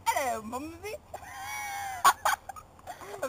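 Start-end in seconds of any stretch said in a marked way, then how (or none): 0.0-1.0 posh British accent, on purpose bad. Friend dares her, she does a bad British accent. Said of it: "do your British accent".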